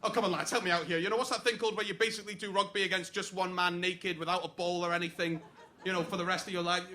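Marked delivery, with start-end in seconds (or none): none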